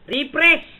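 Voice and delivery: shout. Real, not acted. Trash-talking opponent, yells command.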